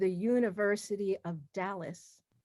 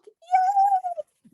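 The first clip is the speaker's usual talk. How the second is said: very high, loud squealed yay; excited cheer; 6 dB louder than usual. Real, not acted. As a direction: let out a high-pitched little whoop. Cheering the Facebook livestream.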